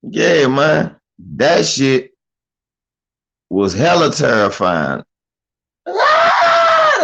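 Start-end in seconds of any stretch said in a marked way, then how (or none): 5.9-7.0 high shrieking mock scream. Comedian imitating the man's terrified scream. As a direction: shriek like a horror victim. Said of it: "screaming like he was watching The Shining".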